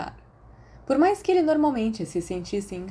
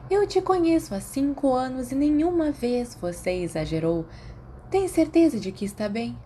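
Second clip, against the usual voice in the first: lightly voiced clucking nurse, a touch higher; a hint of mother-hen scolding; fond clucking; 6 semitones higher than usual. A narrator's reading. Nurse fondly chides Remus for downplaying it, mild clucking. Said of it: "Madame Pomfrey cacarejou".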